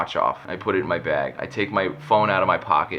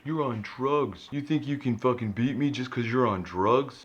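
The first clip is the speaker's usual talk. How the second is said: mocking the yelling guy; 5 semitones higher, 4 dB quieter than usual. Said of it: "Dude is yelling all kinds of shit".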